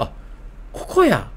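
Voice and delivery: ordinary talk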